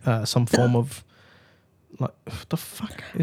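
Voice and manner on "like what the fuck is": hushed aside, breathed out; whispering. Real, not acted. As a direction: drop to a whisper. Trailing off to whisper.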